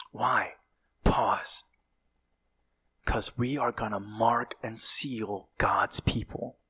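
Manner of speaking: a soft, secretive whisper; hushed whisper. Acted. Preacher telling the church of the sealing, whispered. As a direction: lean in and whisper it. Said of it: "preacher whispering the pause".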